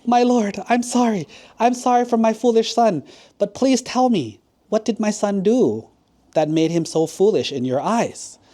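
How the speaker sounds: high-pitched, flustered apology to a king; embarrassed, apologetic courtier; embarrassed advisor. Acted. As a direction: plead with your lord, pitched well up. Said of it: "He was so embarrassed".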